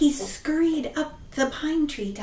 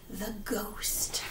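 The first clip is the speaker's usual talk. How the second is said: whispered reveal; hushed eerie whisper; spooky whisper; 7 semitones lower, 13 dB quieter than usual. A narrator's reading. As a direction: breathe the words like a secret. Ghost reveal.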